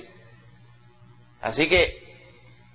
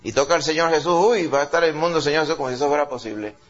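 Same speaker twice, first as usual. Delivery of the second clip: ordinary talk, nothing marked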